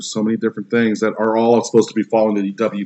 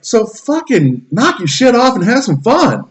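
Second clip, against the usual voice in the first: much higher, slow, fed-up scolding; exasperated rant; 9 semitones higher, slower than usual. Real, not acted. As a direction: lay into them, fed up. Ranting at toxic gamers.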